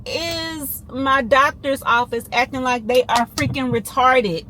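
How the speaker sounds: loud, high, heavily punched words; real angry rant. Real, not acted. Venting to friends about the doctor's prescription mistake, real angry rant. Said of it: "girl, I'm so mad".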